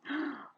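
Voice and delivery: short sharp gasp of alarm. Acted. Boy realising he finished the rhyme, gasping. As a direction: catch your breath in shock.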